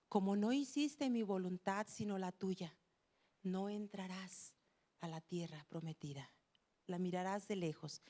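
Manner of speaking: low, soft, grave sentence; God pronouncing judgment. Acted. God bars Moses from the promised land, quietly pronouncing judgment.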